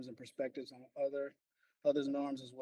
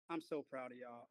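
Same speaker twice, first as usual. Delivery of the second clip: softer, lower heartfelt "I'm so proud of y'all"; softer, lower sincere pride in the class; tender praise; 4 semitones lower, 7 dB quieter than usual. Real, not acted. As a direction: say it gently, meaning it. Teacher praises class at end.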